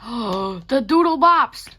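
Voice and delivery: slow, loud, thrilled recognition; excited gasp. Real, not acted. Vlogger spotting her old Doodle Bops drawing, excited and loud. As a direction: drag it out, delighted.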